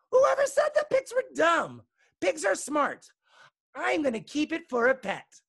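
high, loud outburst easing slightly; indignant protest. A narrator's reading. Megan defends pigs to her teacher, high indignant outburst. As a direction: protest loudly, pitched right up.

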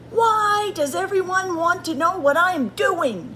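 high exasperated outburst; shouted. A narrator's reading. Bird fed up with everyone asking, shouting. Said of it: "shouted Bird".

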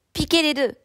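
shouted command. A narrator's reading. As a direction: urge the rival to charge. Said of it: "lui criai-je". (ordre crié d'une voix plus haute)

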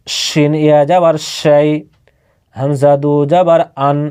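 ordinary talk

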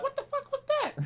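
very high, squeaky retold outburst; high squeal. Gamer acting out a squeaky rage moment. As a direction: squeak it out, mock-rattled. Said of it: "it'd be like".

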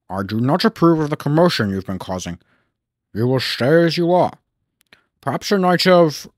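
stern, measured authority figure; put-on pompous boss voice; Fat Controller. A narrator's reading. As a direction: deliver a firm official reprimand. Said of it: "When the Fat Controller visited".

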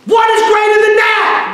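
very high, loud cry to the crowd; climactic preaching shout. Real, not acted. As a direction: shout the question at full force.

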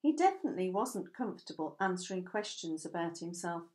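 ordinary delivery

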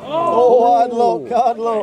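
loud sympathetic call; yelling from the sidelines. Real, not acted. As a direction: shout consolation across the pitch. Consoling player from sidelines.

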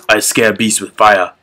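gruff caveman growl. Acted. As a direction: grunt it like a caveman. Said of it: "you do a really good ca-, uh, caveman voice".

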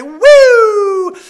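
loud, high thrill whoop; loud excited whoop going down the slide; gleeful scream. Retold or imitated. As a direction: yell like flying down a slide. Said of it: "I'm screaming".

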